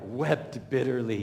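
a lightly voiced mock of Pastor Doug's dramatic read; mild mock of the pastor's emotional reading; imitating Pastor Doug. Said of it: "Pastor Doug, you know ... writing it out".

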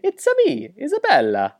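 very high cartoon Italian accent; Super Mario. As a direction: sing it out in mock Italian falsetto. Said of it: "it's almost Super Mario-esque".

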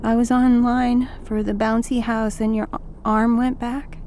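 put-on timid child explaining; lightly voiced shy girl; shy little girl. Child answers shyly.

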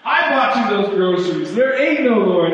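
spring out of the bushes crowing; gloating neighbor. Retold or imitated. Atheist neighbor jumping from bushes to taunt grandma, high gloating voice. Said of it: "he jumps up in the bushes and says".

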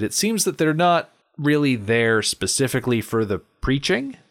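ordinary talk

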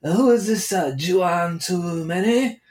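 high, slow mock Korean accent, saying "Juan" as "Chuan"; imagined Korean kid. Korean kid mispronouncing the host's name, mock accent. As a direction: stretch "Too Many" slowly in a put-on Korean accent. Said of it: "or maybe he would say Juan".

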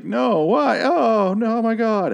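high, panicked cry of disbelief; mock high panic, begging; stabbed and pleading. Sidney stabbed by Agnes, high panicked pleading. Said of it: "and he's just like".

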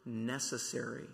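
"necessary" set apart after a short pause, low and soft; emphasis on NECESSARY. Real, not acted. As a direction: weigh the word "necessary" on its own, quietly. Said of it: "I'm, I'm emphasizing the word".

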